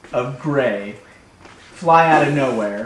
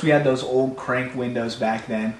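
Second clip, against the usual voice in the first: usual speaking voice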